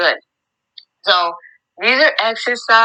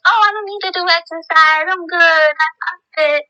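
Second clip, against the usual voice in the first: smug excuse voice; 11 semitones higher than usual. Pretend. Imagined lazy viewer's excuse.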